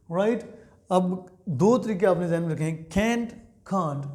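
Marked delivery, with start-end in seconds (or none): 2.9-4.1 "can't" said crisply with the t sounded; careful can't. Shown as a demonstration. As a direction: sound out "can't" slowly with a clear final t. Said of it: "ऐसे pronounce किया जाएगा".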